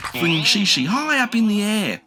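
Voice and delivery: ordinary delivery, nothing marked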